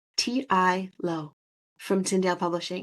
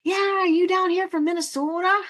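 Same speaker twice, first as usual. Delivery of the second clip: high, loud Minnesota holler; shouting across the street; 11 semitones higher, 6 dB louder than usual. Retold or imitated. Host imitating a passerby hailing someone, high accented shout. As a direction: call out loudly as if across a street. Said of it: "he started shouting across the street to somebody".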